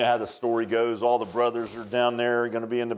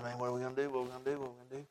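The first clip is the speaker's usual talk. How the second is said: hushed, much softer worried murmur; fading fretful mumble; nervous troops whispering among themselves; scared soldiers; 2 semitones lower, 14 dB quieter, much faster than usual. Retold or imitated. Frightened soldiers voiced.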